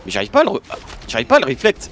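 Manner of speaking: loud frustrated outburst. Real, not acted. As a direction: vent it at the game. Failing a move in game.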